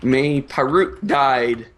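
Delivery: slow, hammed-up grieving captain; mock captain. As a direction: drag out the captain's accusation. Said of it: "the captain says".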